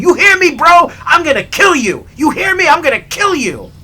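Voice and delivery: high, loud enraged threats; imitated threatening yell. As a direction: shout threats like a furious driver. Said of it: "he starts threatening him".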